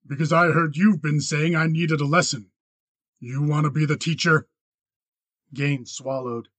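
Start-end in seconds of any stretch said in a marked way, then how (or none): 0.1-4.5 louder, hard confrontational voice; metallic ring. A narrator's reading. Rancher dares his accuser to teach him, metallic ring. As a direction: challenge him with ringing hardness. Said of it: "His voice had a metallic ring".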